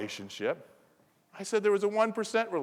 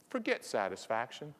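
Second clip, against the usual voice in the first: usual speaking voice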